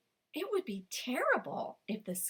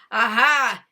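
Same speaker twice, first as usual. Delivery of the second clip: loud, hungry snarl; snarling fox; 13 dB louder than usual. Acted. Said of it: "snarled the fox".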